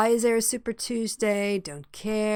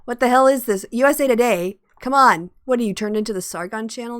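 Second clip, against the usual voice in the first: exasperated; 4 semitones higher, 9 dB louder than usual. Real, not acted. Exasperated at useless news site.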